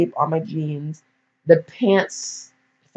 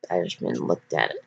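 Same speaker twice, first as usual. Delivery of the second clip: ordinary delivery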